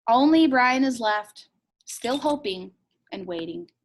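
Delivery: lively read-aloud to the class; animated storybook reading; story narration. A narrator's reading. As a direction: read it like a picture book for kids. Teacher reads picture book aloud.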